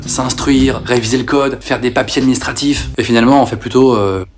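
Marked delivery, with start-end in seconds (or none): none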